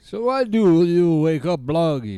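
gruff Eastern European puppet; loud puppet shouting for a cigarette; Bloggy voice. Acted. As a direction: demand a cigarette in broken English.

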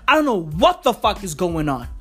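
usual speaking voice

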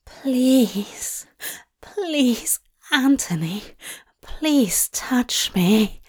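breathy, pleading, slowing to a whisper; desperate plea. A narrator's reading. Lover begging Anthony to touch him, desperate plea. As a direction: beg with ragged breath. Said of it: "Aziraphale read, a desperate plea".

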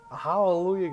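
a loud, high burst of joy; excited hallelujah. Real, not acted. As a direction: cheer it out loud with real relief. Cowboys fan cheering rival's loss.

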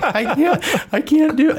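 mock whimpering. Runner whining to his wife that he can't continue, mock crying. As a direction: imitate yourself sobbing that you can't go on. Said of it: "I'm like".

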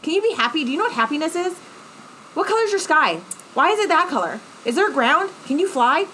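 put-on high, sing-song pestering like a toddler; toddler questions. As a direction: badger them with naive little-kid questions. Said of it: "I mean, like, toddler questions".

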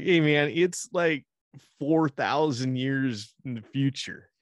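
slow dopey bro news. Retold or imitated. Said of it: "they're like".

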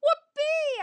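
very high, loud whoop; squealed, high-pitched glee; gleeful bark. A narrator's reading. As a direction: cheer like an overexcited puppy. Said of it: "I bark".

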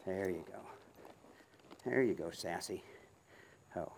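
soft, low praise to horse; calm the mare gently; soothing horse praise. Real, not acted.

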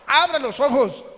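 loud, high burst; loud mock wonder at the wide-eyed baby; exclaiming baby opens eyes. Acting out the wakeful baby.

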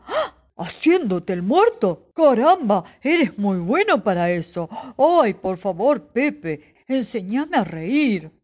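loud astonished bear voice turning pleading; startled bear. Acted. The bear, amazed, begs Pepe to teach him, loud and startled. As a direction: jump in surprise, then beg. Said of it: "El oso se espantó sorprendido".

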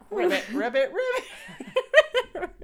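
loud rising ribbit; frog croaks. Shown as a demonstration. Animal sound warm-up.

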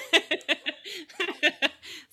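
high, real burst of laughter; giddy laugh. Real, not acted. Fan laughing at her own confession, giddy laugh.

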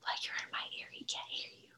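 quick hushed whisper. Real, not acted. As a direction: whisper so no one at the door hears. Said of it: "I don't wanna talk super loud".

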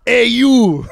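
put-on loud, high French-accented challenge; angry French accent. French fan taps him on the back, mock-angry French accent. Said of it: "the two French guys are like".

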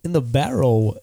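halting delivery with odd pauses; swooping, stop-start cadence; Christopher Walken. Acted. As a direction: speak in broken phrases, each peaking then dropping. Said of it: "let's hear Christopher Walken first".

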